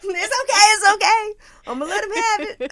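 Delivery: high, loud, choked-up mock sob; mock consoling herself. Wife letting husband have his moment, mock consoling herself. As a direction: pretend to bravely console yourself, high and loud.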